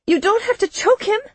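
very high, pleading protest; girl's high, upset cry; distressed plea. A narrator's reading. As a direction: plead for him, pitched far up.

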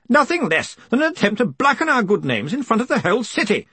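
high-pitched indignant protest. A narrator's reading. Stoley complains about blackened names, indignant protest. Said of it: "Stoley was protesting".

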